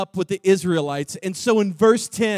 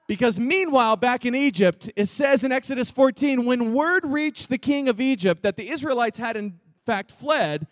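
ordinary delivery

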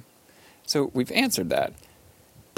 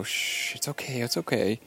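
soothing a scared dog; 3 semitones higher, 3 dB quieter than usual. Retold or imitated. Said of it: "you pet the dog and you go".

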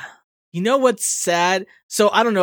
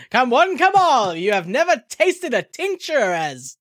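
high, hammy carnival pitch; snake oil seller; 6 semitones higher than usual. Acted. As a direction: hawk it like a fairground barker. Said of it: "a snake oil salesman".

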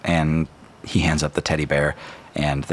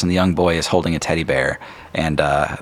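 ordinary talk, nothing marked

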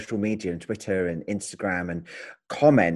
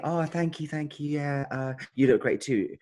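higher, softer mock-modest reply; politely fluster through a compliment; imitating bashful thanks; 6 semitones higher, 4 dB quieter than usual.

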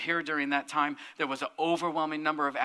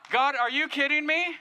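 laughing, higher, louder cry of disbelief; half-laughing "are you kidding me" to God; exasperated disbelief; 8 semitones higher, 5 dB louder than usual. Real, not acted. Pastor reacts seeing psychic.

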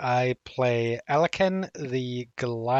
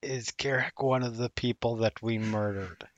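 leaning in, hushed; 6 semitones lower, 6 dB quieter than usual. Acted. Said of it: "lean over to Max and say".

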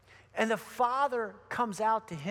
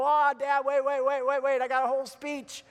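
hurried protest, raised and insistent; urgent interruption; 4 semitones higher, 4 dB louder than usual. Retold or imitated. Son stopping his hugging dad to give his speech, flustered interruption. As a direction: cut in over an eager father, repeating yourself.

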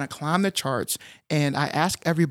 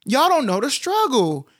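animated teasing; 7 semitones higher than usual. Real, not acted. Teasing younger listeners about struggle.

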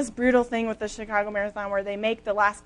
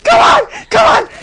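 shouting; 14 dB louder than usual. Retold or imitated. Runner yelling self-encouragement.

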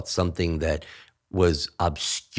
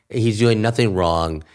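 ordinary talk

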